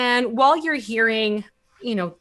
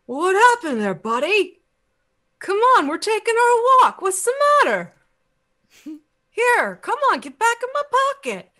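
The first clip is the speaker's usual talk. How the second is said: put-on high, loud young boy's voice; a kid cheerfully calling his pet toad back; 12 semitones higher, 5 dB louder than usual. Young boy coaxing his pet toad into his pocket, high and bright. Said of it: "you suddenly hear this voice going".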